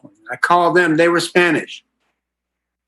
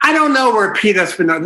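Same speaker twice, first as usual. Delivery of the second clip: grudging excuse; 7 semitones higher, faster than usual. Retold or imitated. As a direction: play it like you'd rather not help. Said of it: "this is how cooperative I can be sometimes".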